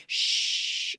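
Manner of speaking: whispered shush; hush. Retold or imitated. Simon sneaking up behind her, whispering.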